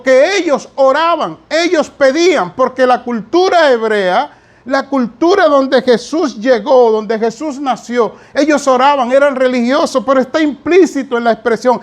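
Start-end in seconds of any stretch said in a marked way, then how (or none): none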